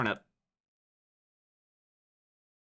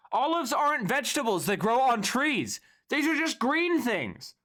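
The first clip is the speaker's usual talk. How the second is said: high, louder indignant complaint; getting mad; 11 semitones higher, 4 dB louder than usual. Real, not acted. Streamer getting mad at the tier list, raising his voice. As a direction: fume at the list, exasperated. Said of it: "I'm getting mad".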